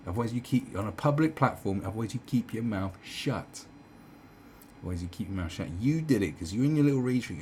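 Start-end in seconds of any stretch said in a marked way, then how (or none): none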